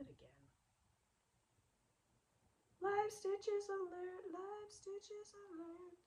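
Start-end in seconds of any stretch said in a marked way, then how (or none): 2.8-6.0 high, slow siren-like alert; mock alarm announcement. Knitter warning viewers of live stitches, mock alarm. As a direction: sound a pretend alarm, high and drawn out.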